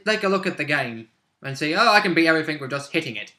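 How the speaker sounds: usual speaking voice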